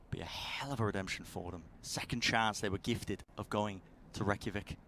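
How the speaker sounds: low, quiet dramatic build-up; hushed caster narration. Acted. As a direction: hold it down, let tension simmer.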